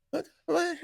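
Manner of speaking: mock whimper, very high-pitched; squeaky, hammed-up tearful stammer; about to cry. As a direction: imitate a crushed kid on the verge of tears, half laughing. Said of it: "Ready to cry".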